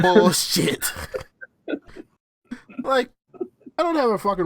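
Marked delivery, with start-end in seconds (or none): 0.0-0.9 loud, raised scoff; loud mocking dismissal; mock disbelieving yell. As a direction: call it out like a heckling player. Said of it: "They're like".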